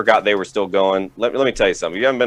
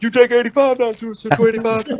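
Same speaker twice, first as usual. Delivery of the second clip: dad voice; 14 semitones higher than usual. Retold or imitated. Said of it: "he's like".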